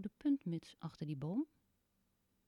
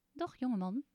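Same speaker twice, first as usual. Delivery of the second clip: little man's voice; 6 semitones higher, 4 dB louder than usual. Acted. Little man greets Teun.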